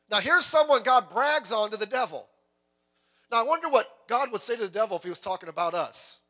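usual speaking voice

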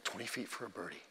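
hushed, low golf announcer whisper; quiet golf commentator voice. Retold or imitated. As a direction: murmur it like a golf broadcast.

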